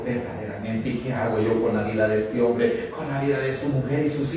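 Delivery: low, softened inner thought; quiet pondering. Acted. Master weighing the servant's life, quiet pondering. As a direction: think it over in a low, soft voice.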